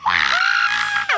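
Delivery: put-on very high, thrilled shriek of teen girls; mock girls' scream. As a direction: shriek like friends cheering from a car. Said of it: "my girlfriends were like".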